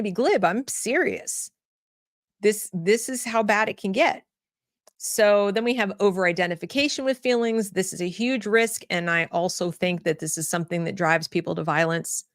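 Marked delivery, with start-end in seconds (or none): none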